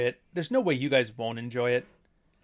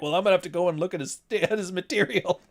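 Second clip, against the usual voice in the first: mock sheepish; 5 semitones higher than usual. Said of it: "he's like".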